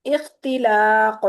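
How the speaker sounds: इख्तिलाक slow and loud, last क़ bounced; slow qalqalah demo. Shown as a demonstration. Teacher modelling a word for students. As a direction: drag out इख्तिलाक and echo the qaf.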